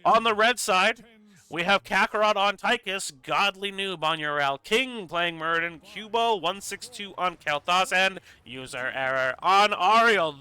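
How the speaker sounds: hyped esports caster introducing the teams; announcer intro. Acted. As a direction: boom out the team introduction.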